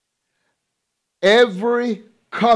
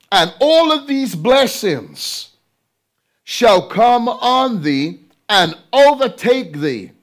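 higher, slower, declaiming the blessing; proclaiming scripture; 4 semitones higher, 3 dB louder, slower than usual. Real, not acted. Preacher proclaiming the blessings of Deuteronomy to his church. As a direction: ring it out as a promise.

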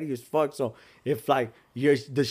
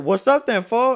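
re-enacted challenge, pitched up; mildly raised, confrontational retelling; heated retelling; 7 semitones higher, 4 dB louder, slower than usual. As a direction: call him out, replaying the row. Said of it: "I got heated".

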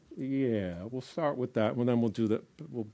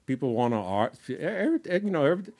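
ordinary talk

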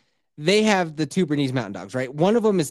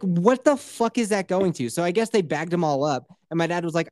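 ordinary talk

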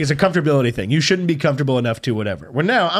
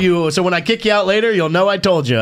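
lightly scolding substitute, pitched up; raised-pitch mock telling-off; fun substitute teacher; 6 semitones higher than usual. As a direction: tell them off like a substitute teacher. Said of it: "You're like the fun substitute".

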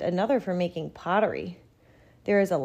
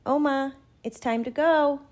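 1 mother calling; 8 semitones higher, 4 dB louder than usual. A narrator's reading. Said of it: "says my mother".

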